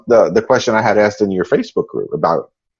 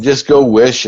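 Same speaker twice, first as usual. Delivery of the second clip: ordinary talk, nothing marked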